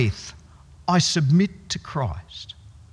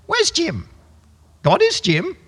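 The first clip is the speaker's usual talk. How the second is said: higher squawky bird voice, mixing words; budgie voice; 11 semitones higher than usual. Retold or imitated. Blasphemous budgie mixing its phrases, light bird voice. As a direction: chirp it like a confused parrot. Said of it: "he started coming out with".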